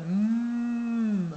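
"M" as one long steady hum, mmmm; insisted consonant sound. Shown as a demonstration. Helping a child choose the consonant, "M" hummed long. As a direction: hum the sound of "M", not the letter's name. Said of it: "insister, hein, sur, euh, sur le son".

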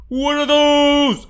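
slow, loud, high mock outrage; mock disgust. Real, not acted. Gamer mocking character's shoes, slow loud mock. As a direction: meme voice at the shoes.

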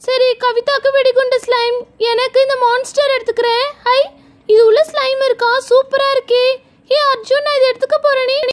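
put-on high-pitched child choosing a treat; delighted little girl. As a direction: chirp happily as a small girl. (மகிழ்ச்சியான சின்னப் பொண்ணு குரல்)

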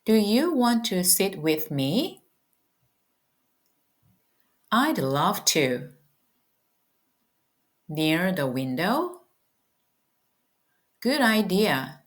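slow, carefully spaced English lines; slow repeat-after-me. Shown as a demonstration. Teacher leading students to repeat, slow and clear. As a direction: say each line slowly for learners to echo. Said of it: "따라해 보실게요".

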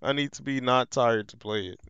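ordinary talk

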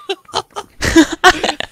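shrill burst of laughter; high squealing laugh. Real, not acted. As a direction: cackle high and loud. Laughing at strangers' reply.

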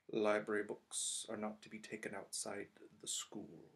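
slow, soft, icy rule-stating. Acted. Snape confiscating Harry's book, icy and slow. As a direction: lay down the rule quietly and slowly. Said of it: "said Snape".

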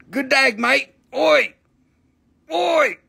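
high, punchy Aussie call-out; mock Australian accent. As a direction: toss out a jokey Australian greeting. Mock Aussie greeting and calls.

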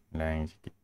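normal talking voice

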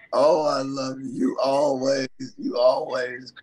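ordinary delivery

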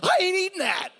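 high, loud indignant refusal; squealing mock exorcism; mock outraged shout. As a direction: cast out the demon like a hammed-up preacher. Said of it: "I'm like".

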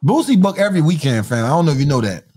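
usual speaking voice

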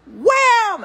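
loud, high mock punch noise; punch sound. Lady Peri's punch landing, a loud mock wham. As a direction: smack it out like a fist landing. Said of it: "And she went".

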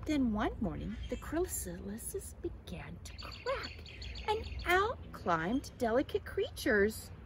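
ordinary delivery, nothing marked

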